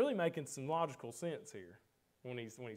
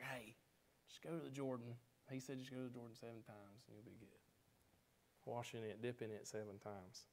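much softer, casual messenger through a cracked door; offhand messenger; 11 dB quieter than usual. Retold or imitated. Messenger at the cracked door brushing off Naaman, soft and offhand. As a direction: toss it off quietly, barely bothering. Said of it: "just cracking that door open".

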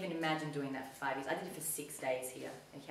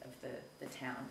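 ordinary delivery

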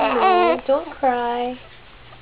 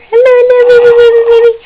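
much higher and louder squealed hello; 11 semitones higher, 16 dB louder, much faster than usual. Real, not acted. Playful greeting to baby.

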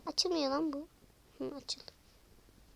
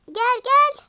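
much higher, loud call; urgent call; 8 semitones higher, 14 dB louder than usual. Acted. Pulling the frozen doll out, calling urgently. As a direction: call them over fast.

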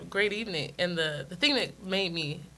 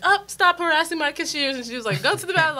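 bossy manager; 10 semitones higher, 8 dB louder than usual. Retold or imitated. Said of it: "front-end manager was like".

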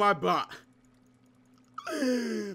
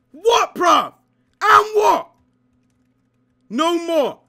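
high, loud hyped taunting; excited taunt; 10 semitones higher, 11 dB louder, slower than usual. Real, not acted. Gamer taunting the enemy he just beat, hyped. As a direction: crow over the fallen enemy.

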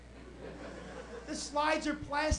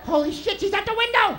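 loud, climbing higher in panic; alarmed yell; 7 semitones higher, 9 dB louder than usual. Retold or imitated. Kids in the car spotting her at the window, alarmed yell. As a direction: blurt it out, alarm rising.

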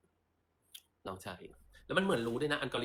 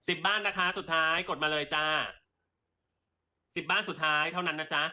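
higher, brighter sales call to viewers; live sale pitch; 4 semitones higher than usual. Acted. Seller urging live viewers to tap the basket, upbeat sales pitch. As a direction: coax the viewers to buy.

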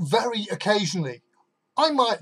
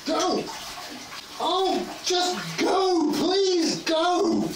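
frantic pleading; 9 semitones higher, 6 dB quieter, much slower than usual. Acted. Begging the flush.

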